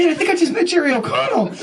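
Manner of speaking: high, giddy starstruck squeal; starstruck excitement. Retold or imitated. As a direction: blurt it out, voice way up with excitement. Retelling his thrill after meeting hero.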